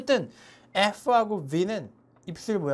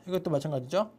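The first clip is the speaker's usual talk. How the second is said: ordinary talk, nothing marked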